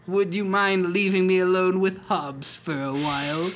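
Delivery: a dying husband sending his wife away; old dying man. Acted. Dying husband to his wife, high and pleading.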